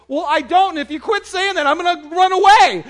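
put-on high, loud kid's protest; indignant little boy. Little boy fending off a girl's crush question, indignant. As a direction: build to a mock-panicked little boy yell.

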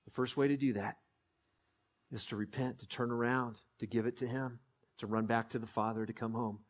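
low, soft, intimate preaching; quiet preaching. Real, not acted. Pastor speaking gently to the congregation, hushed. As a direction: draw them in with a hushed voice.

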